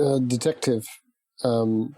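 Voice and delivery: ordinary delivery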